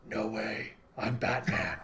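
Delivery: a hammed-up brooding Batman, dropped low. Host voices Batman turning down Superman's invitation in a low mock growl. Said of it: "And Batman's like".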